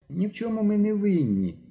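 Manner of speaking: high, trembling, frightened plea; a scared boy insisting. Acted. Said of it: "тремтячим голосом".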